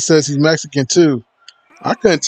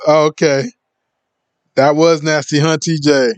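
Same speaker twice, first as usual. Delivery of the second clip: ordinary talk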